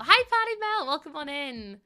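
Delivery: high, loud cheery hello; bright greeting. Real, not acted. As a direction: greet them with a big lift. Greeting viewer in chat.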